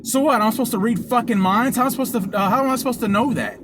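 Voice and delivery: high, exasperated comeback, re-enacted; retold indignant rhetorical questions; loud retort. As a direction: act out arguing loudly, incredulous. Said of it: "'cause we're loud".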